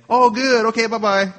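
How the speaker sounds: high, loud sing-song dismissal; oblivious cheerful brush-off. Retold or imitated. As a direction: chirp it off without hearing a word. Not listening, brushes friend off.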